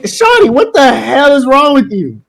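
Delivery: high, slow, stretched-out protest; retold exasperated outburst. Boy confronting girl who flipped his desk, retold exasperation.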